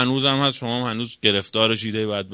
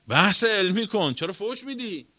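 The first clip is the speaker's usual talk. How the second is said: higher-pitched, protesting question; exasperated challenge; 6 semitones higher than usual. Acted. Preacher challenging an opponent who curses in debate, exasperated.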